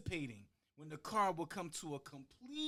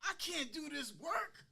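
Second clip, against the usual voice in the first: much higher, whining schoolboy; pleading kid; 12 semitones higher than usual. Retold or imitated. Boy complaining to his dad about schoolwork, high whine. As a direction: plead like a frustrated child. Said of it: "and I said".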